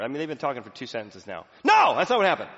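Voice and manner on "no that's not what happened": loud, high exasperated cut-off; defensive interruption. Retold or imitated. Poor listener finally interrupting the speaker, loud defensive outburst. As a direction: snap in and cut them off. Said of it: "you just can't take it anymore".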